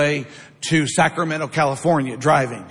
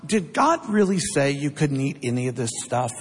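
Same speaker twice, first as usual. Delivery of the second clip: sly serpent; 3 semitones lower, 3 dB quieter than usual. Acted. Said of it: "He says to Eve".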